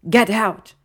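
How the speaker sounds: loud, high urgent yell; shout. A narrator's reading. Draco to Hermione as their car sinks, shouting. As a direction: shout it as an order. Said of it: "he shouted".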